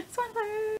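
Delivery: high squealed ship name through a laugh; fangirl squeal. Real, not acted. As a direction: squeal it like a giddy fan.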